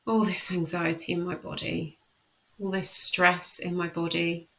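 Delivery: calm, measured self-talk while tapping. Shown as a demonstration. Coach demonstrating tapping, calm affirmation voice. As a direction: recite the tapping phrases steadily, soothing yourself. EFT tapping demo script.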